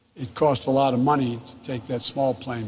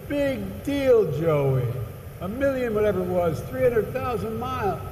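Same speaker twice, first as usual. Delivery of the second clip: hammed-up conductor teasing his famous passenger; conductor's voice; 9 semitones higher than usual. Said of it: "And he said".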